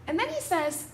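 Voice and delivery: ordinary talk, nothing marked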